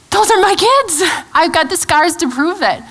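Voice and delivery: high, pleading mother's protest; insistent protest. Retold or imitated. Acted protest to God over her kids.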